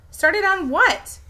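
confused question. Acted. Melody asking, confused.

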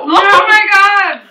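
slow, loud, high Oh my God; shocked. Real, not acted. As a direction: drag it out in disbelief. Reacting to jelly bean taste.

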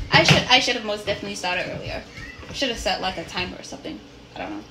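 normal talking voice

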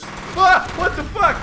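high, loud frightened outburst; startled shout. Real, not acted. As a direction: yelp then swear in fright. Sudden scare in game.

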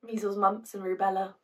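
soft and spent after an outburst; quiet, deflated aside; subdued afterthought. Acted. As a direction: murmur it wryly to yourself.

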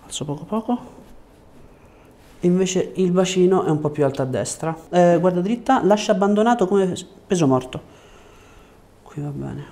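ordinary talk

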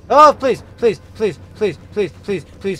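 frantic loud begging; terrified pleading, high and loud; panicking gamer. Real, not acted. Begging while chased in game.

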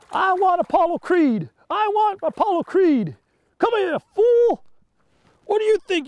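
high, loud put-on trash-talk voice, shouting at the end; mock-challenge the fish like a brawler; tough boxer taunt.

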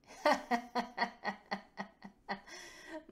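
long, high acted laugh. A narrator's reading. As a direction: let out a sustained, rising laugh. Laugh after showing her moon.

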